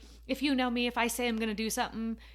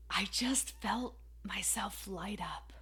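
soft unvoiced whisper, slow; 2 semitones lower, 6 dB quieter, slower than usual. Real, not acted. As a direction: breathe it out like a secret. Quiet confiding moment in story.

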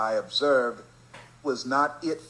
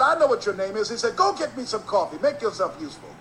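bossy order; 8 semitones higher than usual. Retold or imitated. Boss orders the kid.

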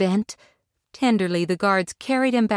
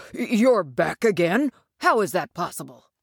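surprised guard. A narrator's reading. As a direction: sound amazed they returned.

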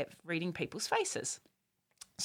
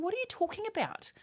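put-on puzzled teen girl; 13 semitones higher than usual. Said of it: "the other girl's going".

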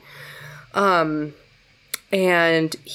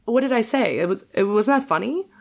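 imitated innocent confusion, higher pitch; sincere, unsarcastic; 6 semitones higher than usual. Ex answers crying partner after plane joke, sincerely baffled. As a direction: play it honestly baffled, pitched up. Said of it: "And not even sarcastic".

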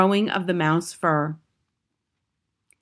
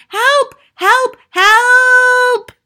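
piercing high cries, the last one held long; terrified screams; 10 dB louder, much slower than usual. A narrator's reading. Boy screams toward the door for outside help, terrified screams. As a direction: scream for rescue, louder on the last. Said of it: "I screamed".

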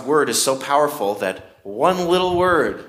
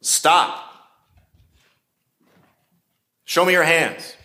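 a mildly raised police order; police command; 4 semitones higher than usual. Shown as a demonstration. Police officer confronting a suspect, lightly voiced command. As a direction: bark it like an officer with a gun drawn. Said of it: "the tone of voice there".

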